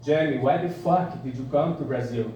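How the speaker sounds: put-on Brazilian accent for a joke. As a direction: imitate Brazilians asking in accented English. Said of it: "it's a really good accent".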